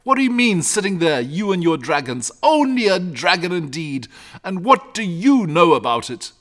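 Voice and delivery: high, loud scolding; indignant cry. A narrator's reading. Father scolding his son over dragons, indignant. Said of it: "cried his father".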